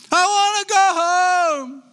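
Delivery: put-on very high, slow whiny small child; imitating small grandson. Grandpa mimicking his little grandson wanting home, very high whiny. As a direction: wail it like a tired little grandson. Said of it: "said my little grandson".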